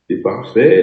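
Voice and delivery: usual speaking voice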